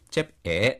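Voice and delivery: チェプエエ with a small break before エエ; sounding out words. Shown as a demonstration. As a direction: split チェプ and エエ with a short catch. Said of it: "じゃあ読んでみますね".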